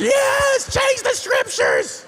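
high-pitched, drawn-out mock cheer; mock triumphant yell. Preacher joking about rewriting scripture, mock triumphant yell. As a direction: yell it high and long like a gleeful rebel.

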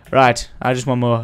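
usual speaking voice

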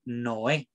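Noé in two syllables, "no-é"; vowels said separately. Shown as a demonstration. Teacher shows what the trema does, Noé with separate vowels. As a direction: split the O and E of Noé. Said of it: "las voy a pronunciar por separado".

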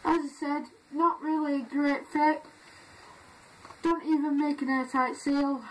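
muffled behind rubber face mask; through a gas mask. Real, not acted. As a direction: voice through respirator filter. Seen 0: Full-face gas mask on throughout speech; removed by 18s.

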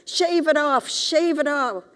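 high, loud repeated demand; insistent mother. A narrator's reading. Mother pressing her son to shave, high and loud.

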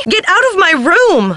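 high-pitched, sharp order to leave; indignant outburst. Acted. Catching Nancy in the room, indignantly ordering out. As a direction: throw an intruder out in outrage.

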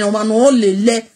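usual speaking voice

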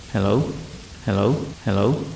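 hello repeated like an echo from a short distance away; echoing mimic voice. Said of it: "as my voice echoed over and over".

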